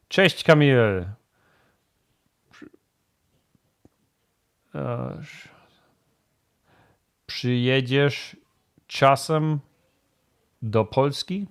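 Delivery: slow, halting Polish, searching for each word; rusty halting Polish. Real, not acted. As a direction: stumble through the Polish like it's rusty. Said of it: "My Polish is so rusty".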